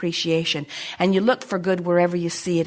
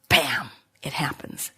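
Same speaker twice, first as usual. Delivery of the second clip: whispered bam; 7 semitones lower, 6 dB quieter, slower than usual. Acted. Sudden payoff after slow build.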